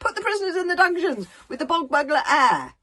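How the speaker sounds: high, loud lordly command; pompous consul order. A narrator's reading. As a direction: dismiss them grandly.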